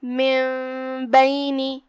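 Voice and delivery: من بینی with noon turned to meem and nasal hum, slow; iqlab. Shown as a demonstration. Teacher demonstrating iqlab, slow recitation. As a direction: say من بینی as mim-baini with ghunna. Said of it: "نون کو میم میں پلٹ کے غنہ کے ساتھ".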